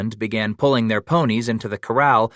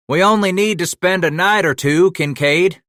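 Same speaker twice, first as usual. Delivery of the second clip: called out higher and louder; hollering; 9 semitones higher, 6 dB louder than usual. A narrator's reading. Scratch calling across the yard after the old man. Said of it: "Scratch hollered after the old man".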